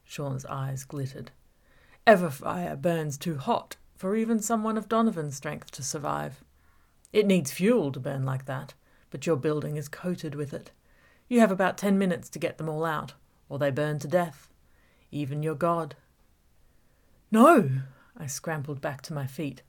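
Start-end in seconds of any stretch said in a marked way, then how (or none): 2.1-15.9 menacing villain toying with Gabby; villain Sean taunting. Acted. Villain Sean's taunting speech. 17.3-17.8 louder, higher desperate shout; desperate shout of denial; horrified cry. Acted. Gabby cries out in horror.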